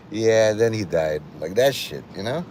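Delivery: ordinary talk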